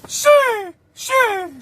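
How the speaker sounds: high calling yell; high shout of a name for help; yelling for his homie. Retold or imitated. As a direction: call out for backup across the pod. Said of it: "He's yelling".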